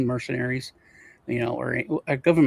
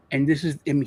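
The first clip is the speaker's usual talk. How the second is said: usual speaking voice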